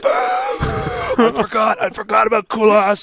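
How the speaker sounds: high, drawn-out panicked wail; panicked realization. Retold or imitated. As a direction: wail it like you just remembered. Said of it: "you're like".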